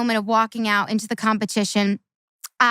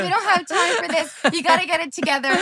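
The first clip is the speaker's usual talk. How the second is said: higher, faster mock-stern urging, with a laugh; teammate urging focus; 6 semitones higher than usual. Teammate rushing a tearful gymnast, higher and quicker. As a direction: hurry her along like a bossy teammate. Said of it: "She's like".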